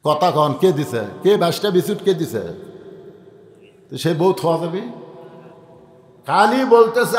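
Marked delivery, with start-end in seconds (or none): none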